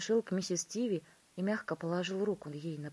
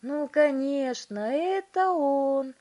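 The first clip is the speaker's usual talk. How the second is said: high, loud, drawn-out cry; excited shout; 8 semitones higher, 10 dB louder, slower than usual. A narrator's reading. Wonka recognising the boy on screen, shouting. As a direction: shout it with certainty. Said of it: "кричал мистер Вонка".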